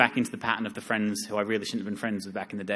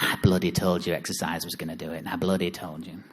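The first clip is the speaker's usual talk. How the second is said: smug nutritionist; 5 semitones lower, 3 dB quieter than usual. Retold or imitated. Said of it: "wry, smug face happening".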